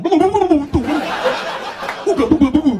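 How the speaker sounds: high, loud, laughing mock boos; booing crowd. Comic mocking the world booing Trump, high loud boos. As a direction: jeer like a whole hostile crowd. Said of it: "A bunch of people going".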